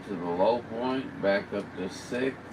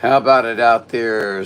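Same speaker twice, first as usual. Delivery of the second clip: loud radio call, much louder; 2 semitones higher, 7 dB louder than usual. Real, not acted. Operator on radio calling for any station, loud call. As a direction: project out over the air to find anyone.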